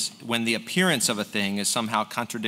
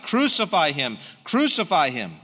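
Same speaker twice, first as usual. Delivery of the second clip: higher, louder repeated shout; crowd crying out; 4 semitones higher, 3 dB louder than usual. Acted. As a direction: demand it as the baying crowd. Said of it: "they cried out".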